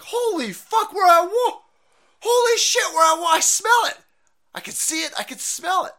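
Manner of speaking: high, hammed-up war panic; panicked people yelling. People seeing equipment blown up, acted panicked yelling. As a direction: yell it like a mock-terrified bystander. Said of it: "people will be like".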